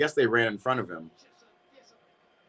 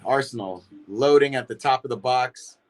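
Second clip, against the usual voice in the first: usual speaking voice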